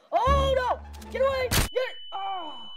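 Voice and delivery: high, very loud startled cries; panicked yell. Real, not acted. Player yells at attackers to get away, panicked.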